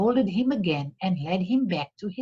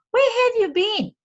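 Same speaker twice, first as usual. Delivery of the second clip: high, startled housekeeper voice; a worried woman asking where he's been; 4 dB louder than usual. A narrator's reading. Housekeeper greets Ali Baba at night, high and startled. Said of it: "asked his housekeeper".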